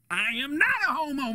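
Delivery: high-pitched, insistent protest; indignant denial. Acted. Friend denying his buddy's taunt, indignant. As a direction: deny it hotly, pitch jumping up.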